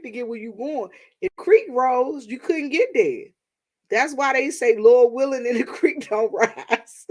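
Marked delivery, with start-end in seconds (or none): 4.8-7.1 laughing through the saying; can't get it out without cracking up. Real, not acted.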